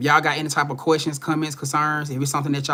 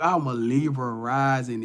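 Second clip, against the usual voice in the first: usual speaking voice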